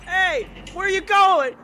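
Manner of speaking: high, loud call after someone. Acted. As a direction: shout after someone walking away. Calling after someone leaving.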